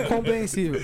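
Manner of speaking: laughing. Real, not acted. Laughter in the group.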